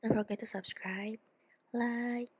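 ordinary talk

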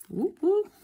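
excited high whoops; whoop whoop cheer. Real, not acted. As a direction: cheer like you won. Celebrating being under estimate.

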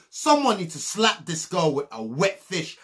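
ordinary talk, nothing marked

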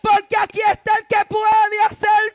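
high, very loud preaching shout, stretched out; triumphant proclamation. Acted. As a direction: roar the good news to the congregation. Preacher proclaims heaven's answer. (proclamación a gritos, aguda y alargada)

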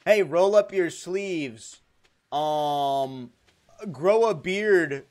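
slow, loud bro advice; hammed-up dating tips; mock advice voice. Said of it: "the top comment will be like".

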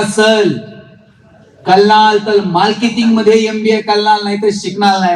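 imitated lisp, every r turned into l; stubborn lisped declaration, r said as l; lisping classmate. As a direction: mimic the boy insisting, "कलनाल" with l for r. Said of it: "एक बोबडं बोलणारं पोरगं होतं".

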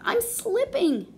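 high, panicky cry for help; a girl slipping off a flying lion's back, crying out in high panic; frightened yell. Acted. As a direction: squeal it as she starts to slip. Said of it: "yelled Katy".

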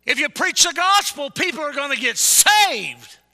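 high, full-throated pulpit yell; preaching shout. Real, not acted. Preacher driving home why we preach the gospel, full shout. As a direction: shout it, stretching the last word.

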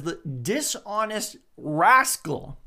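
slow, amused stretch on "the dishonest rascal"; mock-scolding drawl; relishing the phrase. Shown as a demonstration. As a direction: savour the words with a grin. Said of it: "and then it says".

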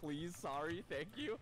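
high, mock-polite Canadian; hammed-up polite Canadian, pitched high. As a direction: play the over-polite Canadian. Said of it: "what is that other thing they say".

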